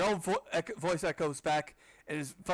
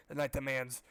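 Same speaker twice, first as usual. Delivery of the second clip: ordinary delivery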